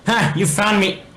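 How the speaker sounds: high, loud teasing cry; playful triumph. Acted. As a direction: gleefully caught him out. Alex teasing as she appears.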